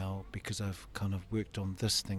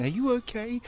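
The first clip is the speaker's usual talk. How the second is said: mock concerned onlooker; 14 semitones higher, 7 dB louder than usual. People at traffic lights.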